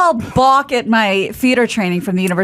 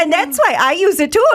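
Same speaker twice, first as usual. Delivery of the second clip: higher, hammier Irish lilt; Irish accent; 6 semitones higher than usual. Shown as a demonstration. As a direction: play up the Irish brogue. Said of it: "Let's hear it, [name]".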